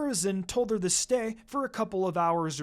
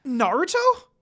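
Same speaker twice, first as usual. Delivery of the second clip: surprised; 7 semitones higher, 8 dB louder than usual. Acted. Surprised to see brother.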